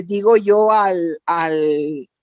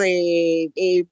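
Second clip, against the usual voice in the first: usual speaking voice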